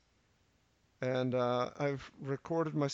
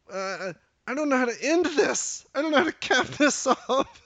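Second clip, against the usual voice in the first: flustered laughing; 10 semitones higher, 9 dB louder than usual. Real, not acted. Flustered, can't find an ending.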